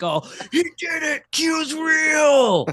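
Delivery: high, shouted mock cheer of a true believer; hammed-up believer cheering; mock Q fan glee. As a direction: shriek with mock triumph.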